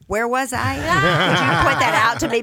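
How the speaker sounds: playfully indignant where-am-I; mock bewildered. Answering fan letters about her cut role, mock puzzled. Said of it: "and I think".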